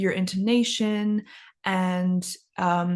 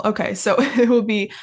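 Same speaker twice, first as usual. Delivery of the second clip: usual speaking voice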